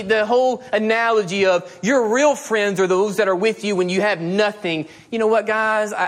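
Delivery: usual speaking voice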